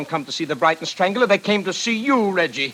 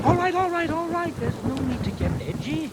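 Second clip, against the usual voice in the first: placating; 8 semitones higher than usual. Acted. Producer placating the angry actor.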